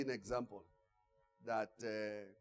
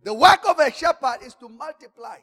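preaching at full force; 7 semitones higher, 10 dB louder than usual. Acted. Preacher raising voice.

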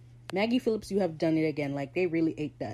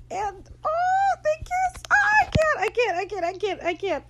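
high, loud squealing that settles down; overwhelmed fan, squealing then fading; fangirl squeal; 5 dB louder than usual. Real, not acted. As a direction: lose it over the kiss. Fan squealing over the kiss.

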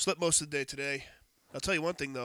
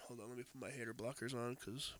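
ordinary delivery

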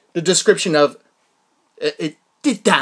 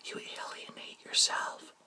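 whisper; 20 dB quieter than usual. Real, not acted. Repeating cousin's words again.

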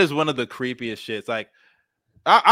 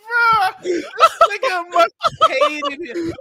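talking through laughter, high; laughing; 4 dB louder, much slower than usual. Real, not acted. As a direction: laugh while saying it, slowly. Laughing at clip.

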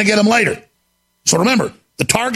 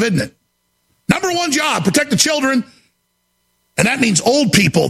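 ordinary talk

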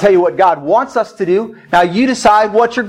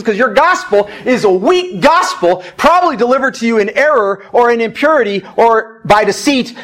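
raised, driving sermon delivery; forceful preaching; 4 semitones higher than usual. Acted. Pastor exhorts people with spirits in their house, forceful preaching. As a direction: preach it insistently, building to a command.

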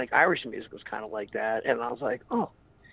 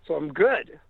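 ordinary talk

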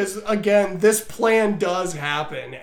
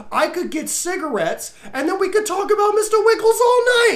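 higher, gushing fake excitement; mock eager guy; 7 semitones higher than usual. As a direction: overact the eager bro. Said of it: "that was pretty much verbatim".

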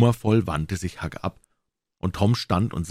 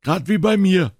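high, loud and eager; boy calling out, pitched up; excited boyish cry; 13 semitones higher, 6 dB louder than usual. A narrator's reading. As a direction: cry out in keen agreement. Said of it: "rief Joe".

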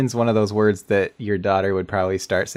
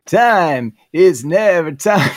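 [name] impression; 8 semitones higher, 7 dB louder, much slower than usual. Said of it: "Do [name]".

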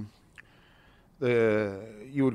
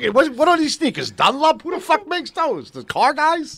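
much higher and louder, scornful; mocking Brooklyn crook; 6 dB louder than usual. A crook mocking Dunlop sneakers, high, loud and scornful. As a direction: sneer at the cheap German sneakers. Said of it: "They're like".

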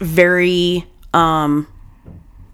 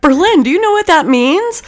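alarmed uncle; 10 semitones higher than usual. Retold or imitated.

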